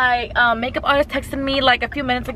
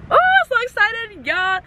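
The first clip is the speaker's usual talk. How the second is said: higher, bubbling over with joy; excited; 9 semitones higher than usual. Real, not acted. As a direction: let the excitement burst out.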